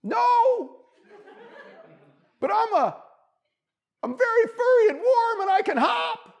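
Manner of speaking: very high, loud little bunny voice; hopeful bunny. A narrator's reading. Bunny tells squirrel what he can do, hopefully. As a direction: call it up haltingly, pleading your case. Bunny pleads his case.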